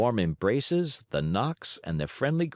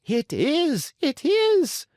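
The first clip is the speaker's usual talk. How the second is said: shrill high shriek, peaking at the end; 5 dB louder, much slower than usual. Acted. Signora Rosaura peeks from the side of the stage, shrieking. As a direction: squeal it with delight. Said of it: "shrieked Signora Rosaura".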